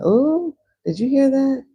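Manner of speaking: startled. Acted. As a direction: jump at a sudden sound. Voicing startled reaction.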